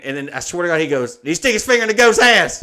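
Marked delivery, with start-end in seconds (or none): 1.2-2.6 loud, high, incredulous holler; hammed-up shouting across a distance; retold shouted question. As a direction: imitate a dad yelling his question uphill. Dad's shouted question, acted out.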